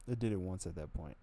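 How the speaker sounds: normal talking voice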